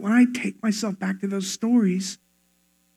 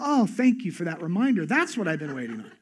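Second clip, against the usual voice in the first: softer, mock-grateful sarcasm; sarcastic gratitude; 4 semitones lower, 7 dB quieter than usual. Angry person answering "calm down", sarcastic gratitude. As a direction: thank them as if you meant it.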